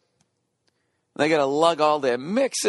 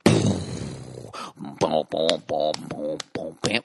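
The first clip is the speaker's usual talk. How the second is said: imitated bass drop; 7 semitones lower, much slower than usual.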